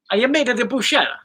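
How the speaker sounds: put-on Italian lilt; Italian-style delivery. Shown as a demonstration. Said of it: "Not saying in Italian".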